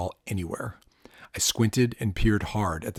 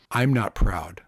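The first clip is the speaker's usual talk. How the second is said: usual speaking voice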